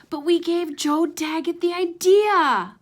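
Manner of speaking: Ace's voice. Acted. Ace protesting in disbelief.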